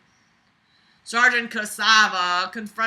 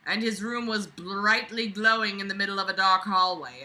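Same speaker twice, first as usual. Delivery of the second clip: ordinary talk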